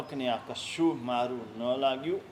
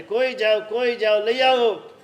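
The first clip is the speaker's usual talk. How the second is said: loud, higher retold cries of a bedridden old woman; shouting for help; 6 semitones higher, 7 dB louder than usual. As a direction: call out urgently from upstairs, louder each time. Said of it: "બૂમો પાડે છે".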